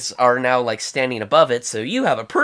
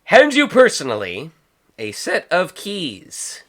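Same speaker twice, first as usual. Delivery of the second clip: usual speaking voice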